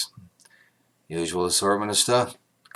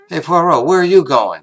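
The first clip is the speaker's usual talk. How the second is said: calling out; 7 semitones higher, 10 dB louder than usual. Acted. Inspector calls after departing Poirot.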